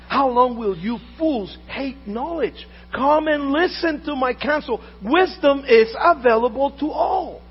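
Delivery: loud, slow proclaiming of the verse; slow, loud pulpit reading; fervent scripture reading. Acted. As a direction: call it out like wisdom pleading. Reads wisdom's call fervently.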